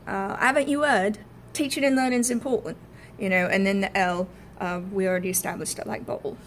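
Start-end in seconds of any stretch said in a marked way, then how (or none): 0.4-2.8 higher, dropped h in haven't and heard; Cockney, dropped h. Shown as a demonstration. Student demos the dropped h in Cockney. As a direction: drop every h, Cockney. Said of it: "and then the H".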